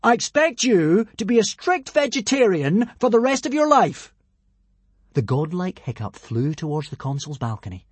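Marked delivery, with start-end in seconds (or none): 0.0-4.1 high, loud godly decree; grand, ringing proclamation; booming command. A narrator's reading. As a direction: lay down the law like a god. Hiccup as god commands consul.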